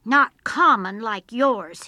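loud, slow comeback; forceful, drawn-out retort; shouted retort. A narrator's reading. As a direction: shout back defiantly. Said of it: "he shouted back".

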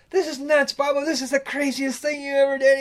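high, loud imitated scolding; hammed-up fed-up wife; wife scolding the skipper from the wheelhouse; exasperated wife.

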